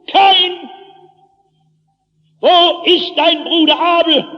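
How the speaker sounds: high, loud, resounding divine voice; Stimme Gottes. Acted. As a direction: thunder the question down at the culprit. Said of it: "einmal tönt eine Stimme". (mit hoher, lauter Gottesstimme rufend)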